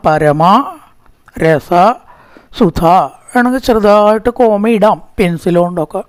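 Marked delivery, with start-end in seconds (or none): 0.1-2.8 പരമ, രസ, സുധ with a pause after each; split into three words. Shown as a demonstration. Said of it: "അത് മൂന്ന് വാക്കാണ്". (പരമ രസ സുധ പിരിച്ച് ചൊല്ലുക)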